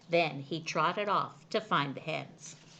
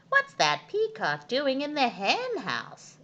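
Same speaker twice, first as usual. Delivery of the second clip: farmer asking; 8 semitones higher, 6 dB louder than usual. A narrator's reading. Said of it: "asked Farmer Tucker".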